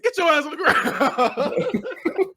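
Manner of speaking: high, drawn-out command through laughter; mock robber's order. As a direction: stretch out the order in a high imitation. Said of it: "He said".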